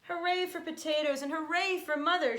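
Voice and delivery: loud, high cheer; cheering shout. A narrator's reading. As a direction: cheer it out like a crowd. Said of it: "shouted the soldiers".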